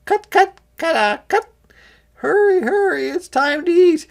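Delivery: high, loud hen voice hurrying; clucking hens. A narrator's reading. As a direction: cluck and hurry everyone to eat. Hens calling to eat.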